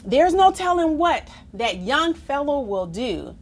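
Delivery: usual speaking voice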